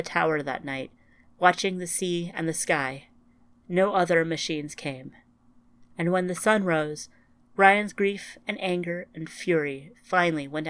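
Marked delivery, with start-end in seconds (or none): none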